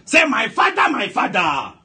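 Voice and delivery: high opening cry falling away; shouted prayer call. Real, not acted. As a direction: call the congregation to repeat, at a shout. Leading congregation in prayer call.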